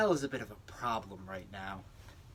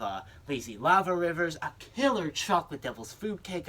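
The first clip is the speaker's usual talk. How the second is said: normal talking voice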